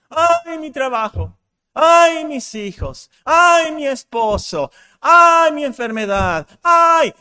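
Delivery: overdone crying complaint, half shouted; mock complaining wail. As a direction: whine like someone who only moans about their troubles. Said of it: "quejándose".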